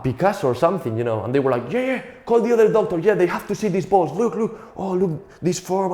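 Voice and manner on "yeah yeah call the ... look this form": high-pitched gushing admiration, hammed up; mock excited doctors. Doctors calling colleagues over to admire, high mock excitement. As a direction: marvel in a high voice, as at a masterpiece.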